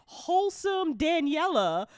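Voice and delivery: ordinary talk, nothing marked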